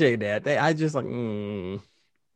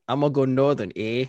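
lightly put-on Canadian accent, a little lower; hint of mock Canadian with a tagged-on "eh"; 5 semitones lower than usual. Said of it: "work on your Canadian accent".

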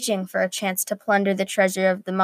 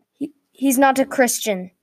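higher, half-raised retort; mild yell; 5 semitones higher than usual. A narrator's reading. Zack retorts to the man in the tower, mild yell. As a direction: argue back with only a touch of yelling. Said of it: "Zack yelled at the man".

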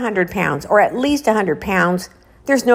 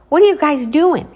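louder, higher demanding shout; angry dad; 9 semitones higher, 7 dB louder than usual. Retold or imitated. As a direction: yell at the kids to explain. Dad sees the tool.

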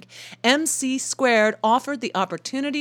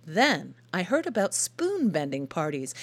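usual speaking voice

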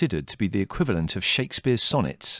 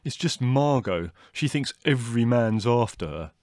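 mildly combative brother, pitched higher; belligerent; 4 semitones higher, 3 dB louder than usual. A narrator's reading. Leslie scoffing at his sister Margot, mildly belligerent. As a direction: dismiss her with a touch of belligerence. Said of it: "said Leslie belligerently".

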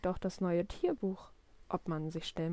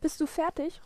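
higher-pitched call from another room; 8 semitones higher than usual. A narrator's reading. Papa calls from the kitchen to Willi, calling out. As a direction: call across to the kid, asking. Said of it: "ruft Papa".

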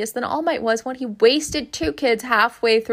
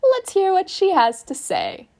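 higher squeaky animal voice, slip of the pronoun; a small animal speaking up brightly; high-pitched animal voice; 8 semitones higher than usual. A narrator's reading. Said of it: "in his high-pitched animal voice".

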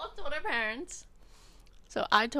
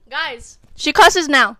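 slow, loud tattling to viewers; loud teasing announcement; 5 semitones higher, 21 dB louder, much slower than usual. Real, not acted. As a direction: drag it out like tattling on her.

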